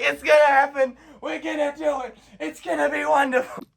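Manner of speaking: high-pitched, giddy self-assurance; overjoyed man hyping himself up; confident excitement. Retold or imitated. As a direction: gush with certainty, pitch way up. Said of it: "I was so confident".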